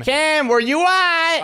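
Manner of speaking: high, stretched-out searching call. Pretend. Seeker calls for hidden Cam, high drawn-out calling.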